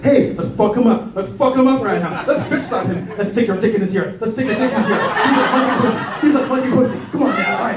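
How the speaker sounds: high-pitched, egging-on gang of monkeys. Retold or imitated. Monkeys plotting against the panicking comedian, rowdy goading. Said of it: "I hear them talking like".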